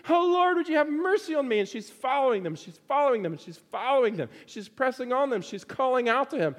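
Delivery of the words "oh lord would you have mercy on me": very high, imploring call; persistent pleading cry. Retold or imitated. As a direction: keep begging as the desperate woman. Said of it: "and she continues".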